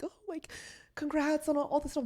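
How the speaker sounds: a light, airy hint of a friendly text; Nikki Glazer quote. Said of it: "Nikki Glazer sent me a message".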